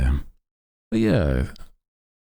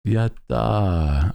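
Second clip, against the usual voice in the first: higher cheerful yatta cheer; cute anime streamer; 6 semitones higher than usual. Pretend. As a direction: chirp it like an anime girl. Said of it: "I do like using a lot of anime slang".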